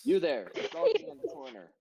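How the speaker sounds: high, loud guard challenge; guard calling out. Acted. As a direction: call out sharply as a guard. NPC guard calls out.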